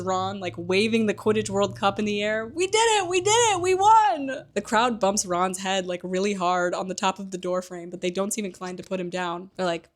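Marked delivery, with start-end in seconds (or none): 2.5-4.4 much higher, loud victory cry; triumphant shouting. Retold or imitated. Ron waving the Quidditch cup, ecstatic shouting. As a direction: yell it like you just won.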